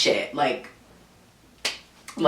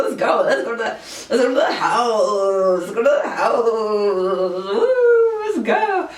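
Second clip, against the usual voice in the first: hyped-up cheering; 4 semitones higher than usual. Retold or imitated. Reveler urging friends to go to the house, hyped-up. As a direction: reenact rowdy excitement, stretching the words into a howl.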